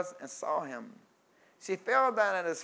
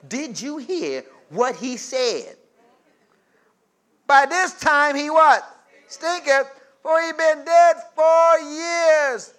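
gossiping Martha; 8 semitones higher, 9 dB louder, slower than usual. Acted. Preacher voicing Martha's gossip.

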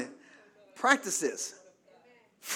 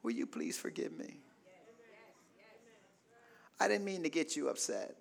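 calm apology; 10 dB quieter than usual. Shown as a demonstration. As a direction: demonstrate a calm, disarming apology, very softly. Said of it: "just calmly say".

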